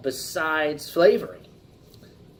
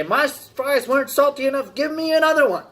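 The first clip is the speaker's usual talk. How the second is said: higher, louder whiny customer complaint; complaining customer; 10 semitones higher, 5 dB louder than usual. Retold or imitated. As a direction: whine like an annoyed customer at the counter. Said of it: "they complain and say".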